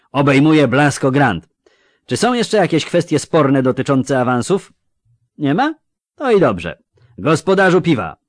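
loud, high commanding call; booming announcement. A narrator's reading. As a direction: address the whole tavern forcefully. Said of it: "ogłosił gromko".

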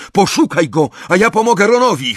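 high, loud, hurried order; urgent shout. A narrator's reading. Harry to Hermiona mid-fight, urgent shout. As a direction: shout instructions, rushing the end.

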